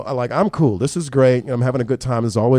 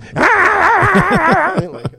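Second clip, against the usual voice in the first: high mom squeal; 6 dB louder than usual. Acted. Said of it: "it sounds like this".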